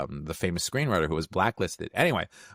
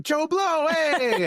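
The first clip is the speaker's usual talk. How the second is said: very high, louder gleeful cry; Bob Odenkirk greeting; 4 dB louder, much slower than usual. Retold or imitated. Actor hailed at a party, thrilled high-pitched greeting back. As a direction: imitate an actor overjoyed to spot a familiar face. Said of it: "and he's like".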